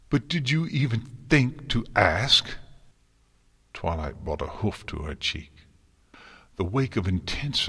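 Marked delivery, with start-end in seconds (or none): 0.1-2.6 higher, louder reproach; hurt accusation. A narrator's reading. Twilight asking if Celestia even thought to ask, accusing. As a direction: throw the question at them, wounded and louder.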